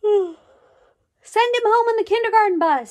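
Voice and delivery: put-on high, slow, loud child's voice; high kid voice. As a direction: pipe up like a little kid. Kid suggests sending Sammy home.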